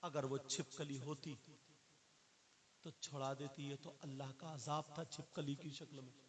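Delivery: ordinary talk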